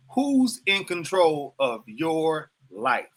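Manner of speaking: very slow, deliberate question, "your" drawn out; stress on YOUR. Real, not acted. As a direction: spell it out slowly, landing hard on "your". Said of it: "I'm putting the emphasis on yours".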